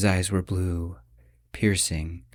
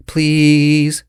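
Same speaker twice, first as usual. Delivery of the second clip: high, much louder whining plea; whined; 6 semitones higher, 11 dB louder than usual. Acted. Girlfriend begging boyfriend for money, whining. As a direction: beg like a spoiled kid. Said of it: "she whined".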